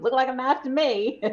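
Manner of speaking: imitation of a Southern mother's accent; thick Southern twang. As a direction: answer in Mom's high Southern twang. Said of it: "she's got a very thick Southern twang".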